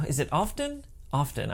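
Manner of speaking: quiet puzzled try at often; often asked, then answered; unsure often, rising then falling; questioning the word. Shown as a demonstration. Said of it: "I can't tell what this word is".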